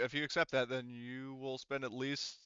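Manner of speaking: ordinary talk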